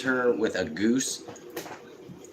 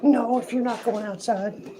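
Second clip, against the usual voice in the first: high-pitched pet character; reply as a pampered pet; talking pet voice; 5 dB louder, slower than usual. Pretend. Pet answers the owner's question.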